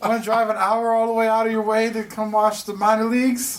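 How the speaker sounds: high, coaxing telemarketer; mock sales pitch. Team rep pitching minor league games to a former customer, high and coaxing. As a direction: sell it in a high put-on voice. Acting out team's ticket sales calls.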